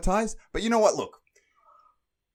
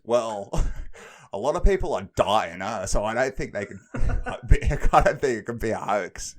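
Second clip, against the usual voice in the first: put-on Aussie accent; 8 dB louder than usual. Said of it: "so Aussie the way this guy said it".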